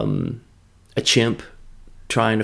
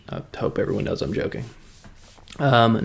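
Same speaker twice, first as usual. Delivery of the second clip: usual speaking voice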